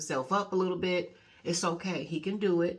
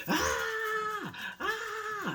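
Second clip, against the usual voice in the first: put-on very high wailing "ah, ah" of a hurt child; mock child's wail, high and drawn out; imitated crying scream. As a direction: mimic a little boy screaming in pain. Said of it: "screaming and crying".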